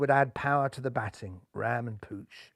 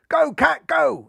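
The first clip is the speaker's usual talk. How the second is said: much higher, louder call to run; cry to partner; 11 semitones higher, 7 dB louder, slower than usual. Acted. Cat batsman crying to his partner to run, high and loud. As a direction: shout to your partner to run. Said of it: "he cried to his partner".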